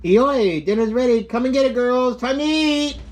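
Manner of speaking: loud high call across the house. Real, not acted. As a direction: call everyone to dinner. Calling the family to dinner.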